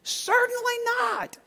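high, prissy "Certainly not"; mock-proper translation reading; high prim reading. Shown as a demonstration. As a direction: put on a lofty tone. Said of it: "What do the other translations say".

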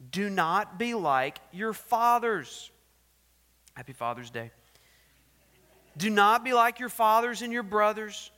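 loud, booming scripture reading; preaching scripture. Acted. Preacher reading the king's letter to the church, booming.

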